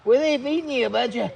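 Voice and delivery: high playful scolding for the dog; teasing the dog. Real, not acted. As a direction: tease the fat dog playfully.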